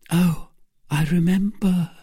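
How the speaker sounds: high, halting recollection; high, failing woman's voice; dying voice. A narrator's reading. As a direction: recall it faintly, high and weak. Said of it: "in a dying voice".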